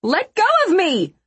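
very high, loud demand; angry yell. A narrator's reading. Woman caught by her purse strap, high angry yell. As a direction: shriek to be released.

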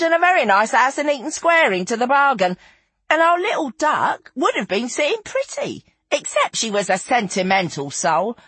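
high, animated raconteuse; high-pitched theatrical tale-telling; Feathers, gleeful storyteller. Acted. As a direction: rattle off the gossip with relish, pitched well up. Narrator voices Feathers telling her tale.

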